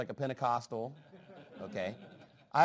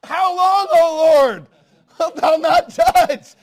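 much higher, much louder cry, slowing on "wilt thou not judge"; imitating pleading saints; 12 semitones higher, 16 dB louder, slower than usual. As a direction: cry out to heaven for judgment. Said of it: "he's like".

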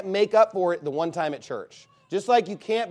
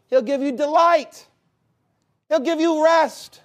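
preaching emphasis; 9 semitones higher, 6 dB louder than usual. Real, not acted. Preacher driving home Bible promise.

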